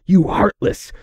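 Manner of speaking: high-pitched, cut-off angry accusation; enraged. A narrator's reading. Friend accusing the princess before being interrupted, enraged. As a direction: hurl it as an angry insult. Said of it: "Discord exploded with rage".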